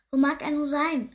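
despairing. A narrator's reading. Hare mother missing her baby, mildly acted despair. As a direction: voice a touch of desperation, high and loud. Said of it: "schluchzt die Hasenmutter verzweifelt".

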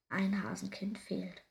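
plain reading voice

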